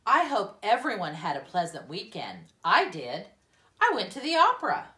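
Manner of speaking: bubbling over with her weekend news; cheerful teacher. Acted.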